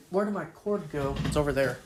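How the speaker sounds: ordinary talk, nothing marked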